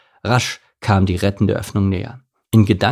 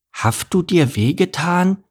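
high, slow lisping donkey voice; sympathetic lisp; 9 semitones higher than usual. A narrator's reading. Donkey checks on the fallen camel, sympathetic high lisp. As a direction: ask with gentle concern in a high lisp. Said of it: "durch das Lispeln".

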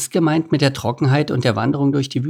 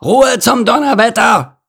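loud, high-pitched furious shout; angry scolding; 13 semitones higher, 6 dB louder than usual. A narrator's reading. Rhino shutting up the crowd, scolding loudly. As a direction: bellow for silence. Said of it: "schimpfte Lord Nase".

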